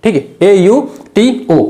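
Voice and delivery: letters spelled out slowly, one by one; deliberate letter-by-letter dictation; slow spelling. Shown as a demonstration. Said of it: "तो auto में क्या करोगे फिर?".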